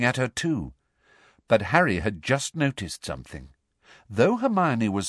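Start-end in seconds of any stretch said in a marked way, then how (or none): none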